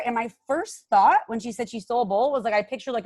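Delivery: normal talking voice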